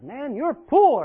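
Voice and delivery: a mocking shout of "you're poor"; blunt taunt. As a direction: call it out slow and loud like a taunt. Someone tells him he's poor.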